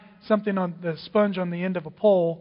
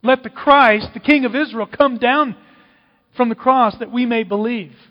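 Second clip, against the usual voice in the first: loud, raised jeer at the cross; mocking challenge; 3 semitones higher, 6 dB louder than usual. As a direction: call it out as a taunting demand. Mockers challenge Jesus to descend.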